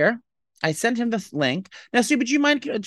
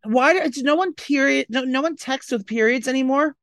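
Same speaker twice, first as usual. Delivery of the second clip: incredulous; 9 semitones higher than usual. Real, not acted. Friend can't believe nobody texts with periods, high incredulous. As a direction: squeal it in disbelief.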